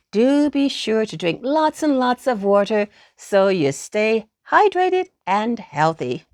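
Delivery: ordinary delivery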